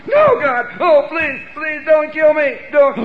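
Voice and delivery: high, loud panicked plea; terrified begging. Retold or imitated. Man pleading before God for his life, desperate shouting. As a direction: beg at the top of your lungs. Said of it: "And here I'm going".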